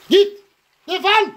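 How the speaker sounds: very high shrieked dismissal; angry shout. Real, not acted. Shrieking at the woman to get lost, furious. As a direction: spit it out to drive her away. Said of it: "beni bağırttırıyor burada ya".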